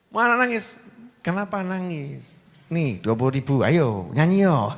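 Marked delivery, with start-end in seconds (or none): 2.7-4.7 lower, playful coaxing; coaxing parent. Retold or imitated. Parent coaxing a crying child with money, low and playful. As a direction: cheer the child up with a bribe.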